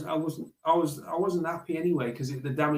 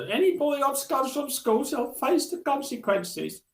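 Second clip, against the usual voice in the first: horrible teacher; 11 semitones higher, 3 dB louder than usual. Retold or imitated. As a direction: ham up a horrid strict teacher. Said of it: "one of them horrible teachers".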